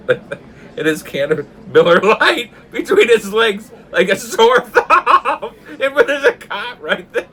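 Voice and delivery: high, loud, drawn-out words through laughing; helpless laughter. Real, not acted. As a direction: keep telling it while cracking up. Cracking up at own story.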